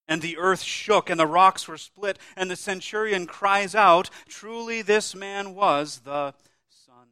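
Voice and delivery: loud, ringing proclamation slowing at the end; full-force preaching. Acted. Preacher to congregation retelling the crucifixion, booming preaching. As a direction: thunder it out from the pulpit.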